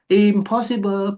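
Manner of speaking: high, drawn-out "impossible"; slow, high-pitched flat refusal; emphatic dismissal. Real, not acted. Flatly rejecting having both lives.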